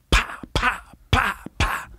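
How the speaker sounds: high, sharp popping sounds, pa pa pa pa; tire puncture pops. Retold or imitated. Kids watching mom stab the tires, imitated popping sounds. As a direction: pop each syllable like a tire bursting.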